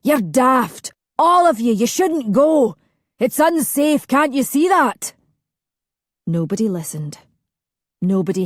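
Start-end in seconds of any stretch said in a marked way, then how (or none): 0.0-5.1 much higher, louder furious yell; angry shout. A narrator's reading. Alistair shouting at his family as they board. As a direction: shout at them to stop. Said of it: "who shouted".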